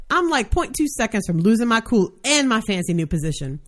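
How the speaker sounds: ordinary talk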